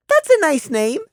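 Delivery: high, sing-song cheer; chiming child. Acted. Prey complimenting the mare's name, chiming child voice. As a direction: chime it like a little kid. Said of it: "Prey chimed".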